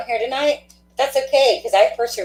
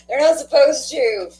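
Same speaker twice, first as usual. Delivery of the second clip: laughing through being touched; 5 semitones higher than usual. Real, not acted. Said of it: "You're gonna make me cry on camera".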